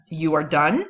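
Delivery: "You are done?" with a rising end on done; uptalk statement. Shown as a demonstration. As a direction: make the statement sound like a question.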